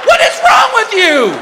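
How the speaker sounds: high, slow shout of outrage; drawn-out, high-pitched scolding; appalled wife. Retold or imitated. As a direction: shout it at a ridiculous husband. Wife berates husband.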